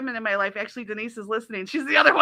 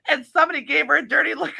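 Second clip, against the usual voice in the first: ordinary talk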